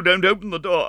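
high, anxious plea; pleading. A narrator's reading. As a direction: beg her not to open it. Meg begs Sorelli.

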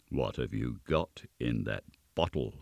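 low, soft interrogation; stern goblin. A narrator's reading. Goblin questions the sexton about his bottle, low voice. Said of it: "said the goblin".

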